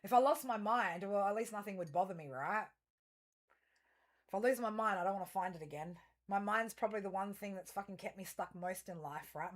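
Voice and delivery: ordinary delivery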